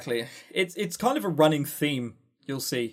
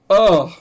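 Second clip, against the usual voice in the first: loud exasperated ugh; louder disgusted ugh; disgusted groan; 4 semitones higher, 10 dB louder than usual. Real, not acted. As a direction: let out a loud fed-up groan. Disgust at the game.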